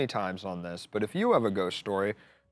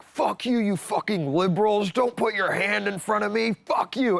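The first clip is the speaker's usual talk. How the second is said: mock angry yell, pitched higher; hammed-up road rage rant; imitating a screaming driver; 7 semitones higher than usual. Imitating the driver who screamed at them, mock yell. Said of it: "starts screaming at us".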